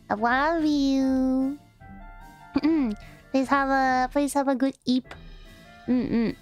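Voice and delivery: ordinary talk, nothing marked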